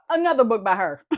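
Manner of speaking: ordinary talk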